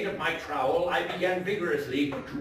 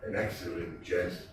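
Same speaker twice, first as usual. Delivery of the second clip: sad voice; 7 semitones lower, 5 dB quieter than usual. A narrator's reading. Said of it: "a sad voice".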